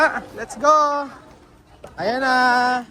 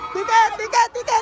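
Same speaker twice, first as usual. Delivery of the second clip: excited calling out; 13 semitones higher than usual. Real, not acted. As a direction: call it out gleefully. Calling out on the ride.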